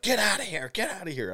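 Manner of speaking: high-pitched mock yell, easing off; retold yelling woman. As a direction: mimic her shooing them away in a high voice. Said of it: "they came out yelling".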